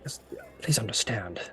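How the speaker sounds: whispered, earnest knight; hushed plea. Acted. Knight asking Terrence to understand, hushed plea. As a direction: plead softly, almost under the breath. Knight quietly pleads with adventurer.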